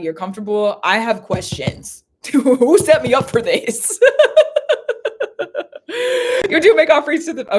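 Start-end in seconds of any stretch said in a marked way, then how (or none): 2.2-7.6 high, loud laughter breaking through the words; can't stop laughing mid-sentence; real laughing fit. Real, not acted. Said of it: "that's really funny".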